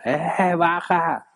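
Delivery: calling upstairs. Retold or imitated. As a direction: call a kid down from the upper floor. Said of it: "sino que le grita".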